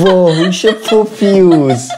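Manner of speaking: slow words through laughter; laughing insult. Real, not acted. Host teasing the clever cat, slow and laughing. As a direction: tease while laughing.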